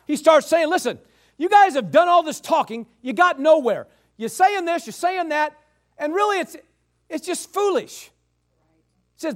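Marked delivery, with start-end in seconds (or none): 0.7-8.1 exasperated scolding, pitched up; Elihu fed up. Acted. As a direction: act out telling the older men off. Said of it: "finally gets fed up with it".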